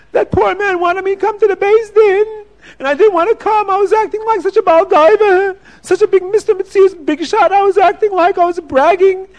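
high, loud crying voice; rising wail of remorse; tearful confession. Acted. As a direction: sob it out high and full of regret. Rich man repents, crying.

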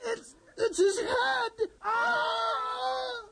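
stammering scream of horror; horrified cry. Acted. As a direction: recoil in terror. Sees the severed head.

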